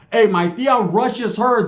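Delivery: ordinary delivery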